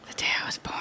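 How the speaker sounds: hushed dramatic whisper. Acted. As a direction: breathe it like a secret.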